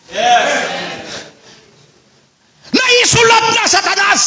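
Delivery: high, ringing pulpit shout; fiery preacher climax; full-force preaching. Acted. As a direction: thunder it out over the congregation.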